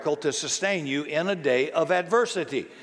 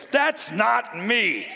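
slow, emphatic, raised-pitch protest; indignant Jesus outcry; 5 semitones higher, much slower than usual. Pretend. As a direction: call it out slowly, each word punched. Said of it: "I bet he would stand up here and say".

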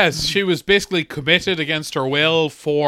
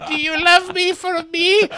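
much higher, pleading mock voice; needy groom; 11 semitones higher than usual. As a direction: plead it high and pathetic. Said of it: "I'm starting to see why you identify".

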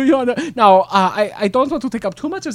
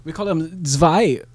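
put-on German accent; 8 semitones lower than usual. German character.

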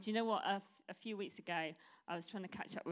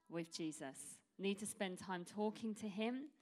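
ordinary delivery

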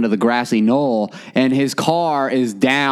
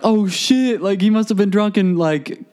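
higher-pitched, alarmed oh shit; startled as it dawns on him; shocked realization; 8 semitones higher than usual. Retold or imitated. Friends spotting the car by the river, mock alarmed. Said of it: "we're like".

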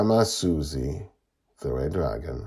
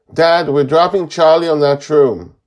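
high, loud, decisive call; 12 semitones higher, 16 dB louder than usual. A narrator's reading. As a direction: shout the order across to Dad. Joy calls orders to Dad.

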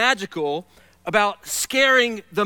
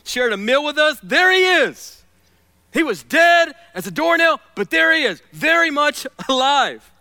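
exultant; 7 semitones higher, 6 dB louder than usual. Real, not acted. Preacher proclaiming Jesus alive to congregation, exultant. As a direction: burst out with joy.

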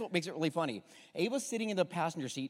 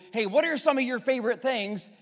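friendly question; 6 semitones higher, 6 dB louder than usual. Shown as a demonstration. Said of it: "But if I say".

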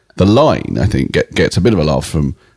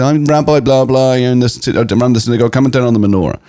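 higher, a lightly put-on confident rabbi; rabbi's voice; 8 semitones higher than usual. Rabbi introducing himself on the phone, mild mock voice. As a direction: mimic the self-assured rabbi. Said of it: "I'm doing the voice and everything".

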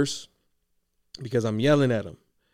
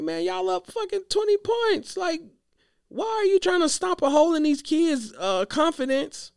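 much higher, incredulous inner voice; inner exasperation. Retold or imitated. Coach reacting silently to the full court press request, high and incredulous. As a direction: disbelief pitched way up in his head. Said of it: "I'm like, in my mind".

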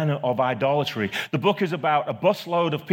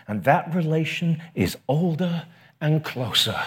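normal talking voice

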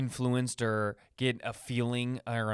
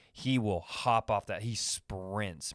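usual speaking voice

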